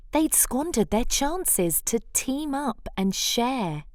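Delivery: ordinary delivery, nothing marked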